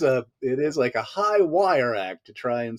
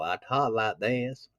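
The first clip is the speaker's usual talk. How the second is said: slow Southern drawl; 5 dB quieter, slower than usual. Shown as a demonstration. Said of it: "I had a thick Southern drawl".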